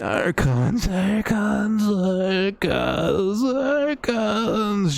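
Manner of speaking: high, drawn-out pleasured whine; whiny moan. Acted. Robed man rubbing the floor in ecstasy, whiny moaning. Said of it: "with this sort of whiny, pleasuring moan".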